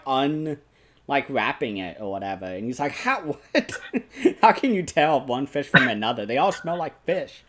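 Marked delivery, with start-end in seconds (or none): 2.9-7.4 real laughter breaking into a retold line, higher; can't get the words out for giggling; laughing through the quote. Real, not acted. Retelling the baffled fisherman to his co-host, laughing all through.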